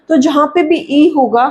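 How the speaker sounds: ordinary talk, nothing marked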